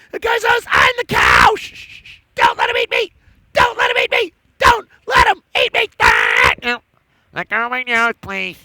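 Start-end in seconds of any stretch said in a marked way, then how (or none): 0.7-5.9 frantic high cow voice pleading to hide; a scared cow begging in a squeaky shout; panicked cow. Acted. Cow begging the storyteller not to be eaten, high panicked squeak. 6.0-6.8 short startled squeal; cow yelp. Acted. Cow puppet grabbed by the nose, sharp squeal.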